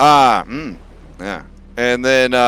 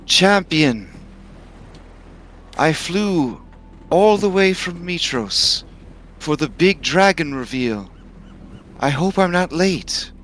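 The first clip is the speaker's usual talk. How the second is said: Silver Queen voice; 6 semitones higher than usual. Acted. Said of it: "She says".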